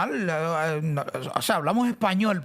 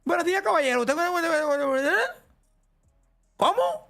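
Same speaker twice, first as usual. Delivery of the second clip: high, rushed bank caller voice; hurried bank caller; 8 semitones higher than usual. Retold or imitated. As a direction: rattle it off like a rushed bank agent. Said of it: "y dicen".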